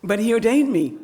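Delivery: triumphant giggle; laughing. Real, not acted. Laughing punchline.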